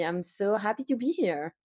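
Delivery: usual speaking voice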